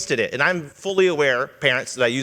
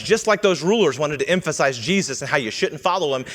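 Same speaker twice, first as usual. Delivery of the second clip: ordinary delivery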